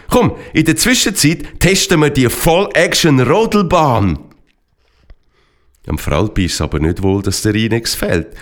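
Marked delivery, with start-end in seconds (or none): 0.1-4.2 higher, eager rallying cry; rally friends to come along; excited call. A narrator's reading. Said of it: "rief der Esteban".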